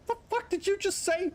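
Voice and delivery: mock baffled outrage. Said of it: "They're like".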